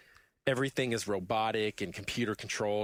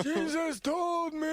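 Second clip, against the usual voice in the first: put-on high, slow, shouted old-man line; imitating a yelling old man; old guy yell; much slower than usual. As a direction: holler it with long drawn-out words. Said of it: "the old guy yells".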